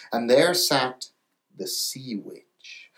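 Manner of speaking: ordinary delivery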